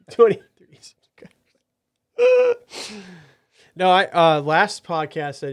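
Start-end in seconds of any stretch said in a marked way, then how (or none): 0.1-1.3 whispered through laughter; laughing whisper. Real, not acted. 2.2-3.1 squeaky high laugh burst; high giggle. Real, not acted.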